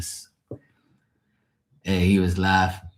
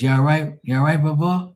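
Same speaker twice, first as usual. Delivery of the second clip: nephew asking; 7 semitones higher than usual. Retold or imitated. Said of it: "She goes".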